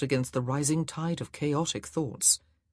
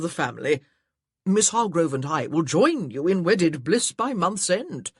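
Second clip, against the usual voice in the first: put-on louder, hearty older gentleman; a jovial, booming suitor; cheerful gentleman; 3 semitones higher, 6 dB louder than usual. Beckington speaks cheerfully.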